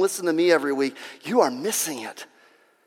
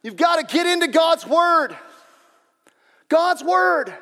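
raised, high-pitched preaching voice; fervent preaching; 11 semitones higher, 6 dB louder, slower than usual. Real, not acted. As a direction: drive it home to the congregation, loud and high. Preaches at full force.

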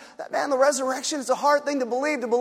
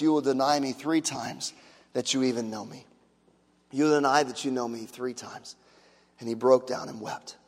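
hushed sorrow; 8 semitones lower, 4 dB quieter than usual. Acted. Peter's grief recalled.

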